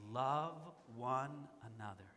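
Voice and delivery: gentle aged apostle's plea; slow, tender words of old John. Acted. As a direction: say it slowly like a frail old man. Aged John's words, slowed.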